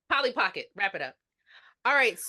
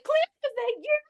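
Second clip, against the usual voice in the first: high hopeful question; hint of a hopeful woman; lightly voiced Sandra. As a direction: ask it high, hoping it's him. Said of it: "Sandra's like".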